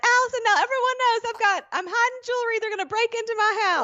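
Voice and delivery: high, fast panicky mom voice; imitating mom. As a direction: squeal it like a fretting mother. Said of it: "She'll be like".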